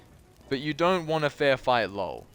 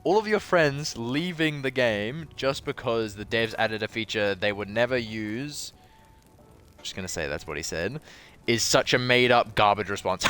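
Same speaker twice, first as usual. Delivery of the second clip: ordinary talk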